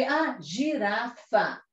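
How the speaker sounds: drawn-out mock "aha" moment; acted realisation. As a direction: act out a young reader working out the word. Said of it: "aí você já sabe".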